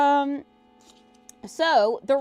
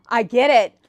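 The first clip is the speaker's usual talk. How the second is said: excited agreement; 3 semitones higher, 10 dB louder than usual. Retold or imitated. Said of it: "And I was like".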